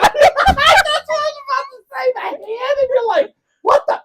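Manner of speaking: laughing hard, voice squeaking up high. Real, not acted. Telling the hand-video story, laughing high-pitched. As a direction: squeal it out through giggles.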